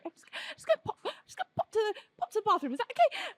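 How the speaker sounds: high, half-voiced, giggly stammer; sheepish excusing. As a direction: squeak an awkward apology as you slip past. Embarrassed, squeezing past a band.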